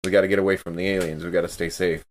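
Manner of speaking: ordinary talk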